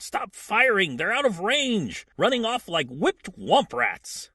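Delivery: loud, high-pitched pirate call. Acted. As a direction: shout orders across the water. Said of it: "One of them calls out".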